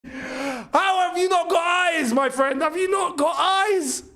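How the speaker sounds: high, slow, exasperated yelling; exasperated shout. Real, not acted. Fan yelling at a player who missed. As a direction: shout it out in disbelief at the miss.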